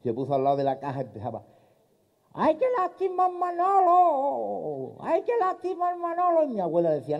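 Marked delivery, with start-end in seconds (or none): 2.3-6.4 put-on very high, drawn-out old man's cry; deaf old man wailing. Deaf old man mourns at the coffin, very high and stretched.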